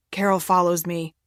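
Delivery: plain reading voice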